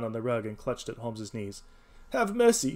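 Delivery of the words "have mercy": much higher, louder shriek. A narrator's reading. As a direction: scream for mercy. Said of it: "he shrieked".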